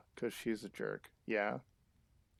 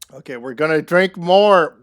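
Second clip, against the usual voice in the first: a loud, high call to drink more; rally the party, much louder; excited announcement; 4 semitones higher, 16 dB louder than usual. Real, not acted. Gamer cheering her cat into drinking, loud and excited.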